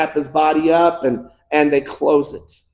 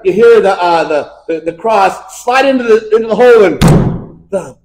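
ordinary talk

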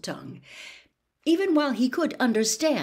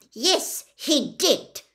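short and sharp, pitched up; snapped reply; 8 semitones higher, 5 dB louder, slower than usual. Acted. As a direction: bite off the words. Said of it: "snapped Ogden".

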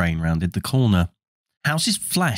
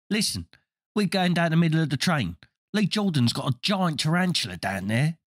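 put-on young boy voice; 6 semitones higher, 3 dB quieter than usual. Twin tells brother news.

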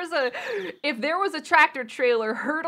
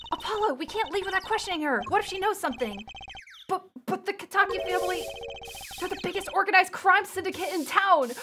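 somewhat higher, urgent character voice; lightly voiced game sidekicks; 7 semitones higher, faster than usual. Urging Apollo to question her, lightly raised character voice. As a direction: pipe up a little higher, urging your partner. Voices young assistant and partner.

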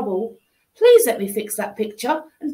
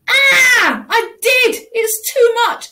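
high loud wailing protest; loud high despairing wail; distressed outcry; 9 semitones higher, 6 dB louder, slower than usual. Acted. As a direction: cry out near tears. Child cries at endless broccoli.

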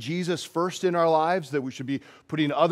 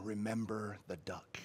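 hushed, softer sly threat; menacing whisper; 6 semitones lower, 16 dB quieter than usual. Retold or imitated. Sally leaning over to threaten Tommy, hushed whisper. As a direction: lean in and breathe it like blackmail. Sister repeats her threat.

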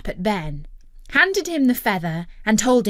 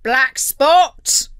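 loud, raised pirate call; commanding pirate shout; pirate captain's cry; 5 semitones higher, 8 dB louder than usual. A narrator's reading. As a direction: holler for a crewman as a pirate captain. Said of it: "cried Captain Blackheart".

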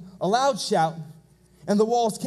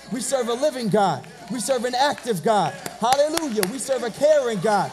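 loud, rhythmic shouted declarations; full-force preaching; 3 semitones higher, 4 dB louder than usual. Real, not acted. Preacher rousing the congregation to praise God, full-force preaching. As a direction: build a chant-like list of praise, punching each phrase.

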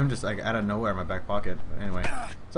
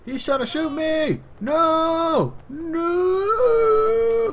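high, loud hammed-up screams of terror; mock panic; 7 dB louder, much slower than usual. Player pretends someone is coming to shoot him, mock panic. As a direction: wail in fake panic, stretching the last cry.

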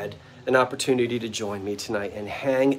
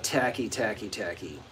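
fading tacky; 4 semitones lower, 8 dB quieter than usual. Real, not acted. Fond mock disdain, fading.

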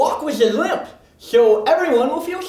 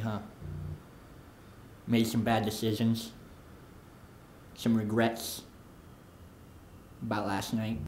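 low, quiet aside; 7 dB quieter than usual. Acted. Low, quiet mock-sympathy.

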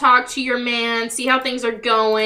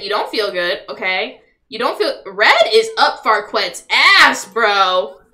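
ordinary talk, nothing marked

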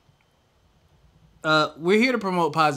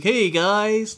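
fake-cheerful greeting; 7 semitones higher, 5 dB louder than usual. Mocking fake-cheerful intro.

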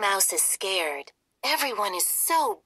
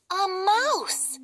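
high startled shriek; frightened cry; 10 semitones higher, 4 dB louder than usual. Acted. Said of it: "and Hildy cries".

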